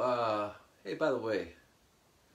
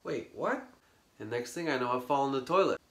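usual speaking voice

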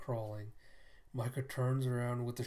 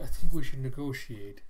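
blurted out much louder; loud panicked plea; 13 dB louder than usual. Acted. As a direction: beg loudly in panic. Guard panics facing flamethrower.

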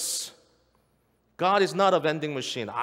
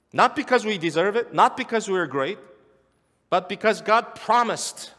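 preaching build; 5 semitones higher, 4 dB louder than usual. Acted. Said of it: "preaching climax".

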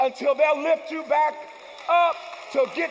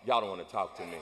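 much lower, offhand aside; muttered aside; 8 dB quieter, faster than usual. Real, not acted. Preacher teasing a silent congregation with a low aside. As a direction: grumble it under your breath.

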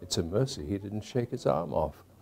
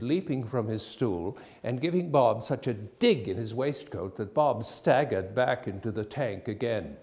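plain reading voice

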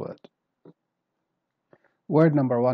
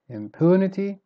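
"Impunity" raised high on "pu"; middle stress; 6 semitones higher, 4 dB louder than usual. Shown as a demonstration. Teacher modelling the word, "Impunity" with middle stress. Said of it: "Starts in the middle".

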